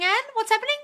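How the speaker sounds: ordinary delivery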